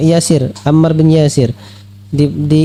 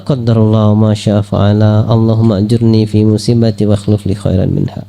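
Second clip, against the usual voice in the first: recited prayer; 7 semitones lower than usual. Shown as a demonstration. Preacher showing the right response to calamity, in a low calm recitation. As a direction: murmur the dua calmly, as a believer accepting fate. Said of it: "yang keluar".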